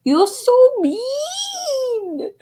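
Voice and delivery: very high, very slow whine with a laugh; imitating little girl. Friend copying the little girl's whine, laughing. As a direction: stretch it like a sulky toddler, giggling. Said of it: "No, it's like".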